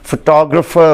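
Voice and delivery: फोटोग्राफर as fuh-TOG-ruh-fer; फोटोग्राफर stressed on TOG; फोटोग्राफर English way; correct English pronunciation. Shown as a demonstration. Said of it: "मैंने आपको बता दिया".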